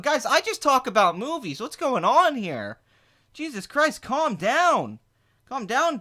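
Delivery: exasperated peacemaker. Acted. Impressionist as a streamer who just talks about movies, high exasperated plea.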